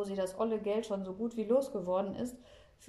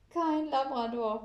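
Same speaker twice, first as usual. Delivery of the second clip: higher, louder, slow wail; 6 semitones higher, 6 dB louder, slower than usual. A narrator's reading. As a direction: whine it out like a child. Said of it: "heult Billy Ballonfahrer".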